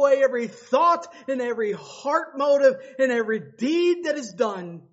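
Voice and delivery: fiery pulpit appeal; preaching at full force. Acted. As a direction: drive the appeal home.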